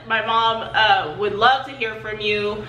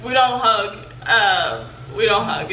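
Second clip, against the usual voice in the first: ordinary talk, nothing marked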